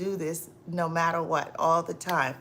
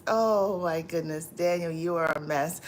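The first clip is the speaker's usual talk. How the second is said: ordinary talk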